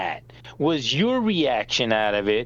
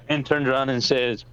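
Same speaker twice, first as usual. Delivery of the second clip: usual speaking voice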